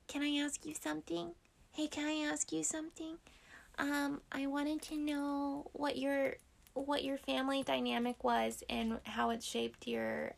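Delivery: put-on high, soft baby talk to a cat; pet voice. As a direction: ask the cat like a toddler, sweetly.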